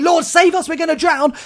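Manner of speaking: high, loud cry of panic; panicked scream. Retold or imitated. Disciples screaming to the sleeping Jesus in panic. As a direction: scream it as the boat goes under. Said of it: "the disciples are screaming".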